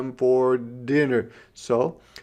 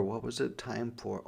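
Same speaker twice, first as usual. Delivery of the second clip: plain reading voice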